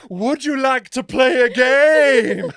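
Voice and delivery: loud, high, slow mock-creepy voice; hammed-up haunted-house actor; drama-school creep. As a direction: draw it out like an overacted villain. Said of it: "so drama school".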